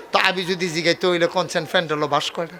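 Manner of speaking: usual speaking voice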